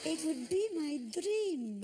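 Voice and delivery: high, sweet, innocent choirboy plea; little choir boy voice. Retold or imitated. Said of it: "in this little choir boy voice".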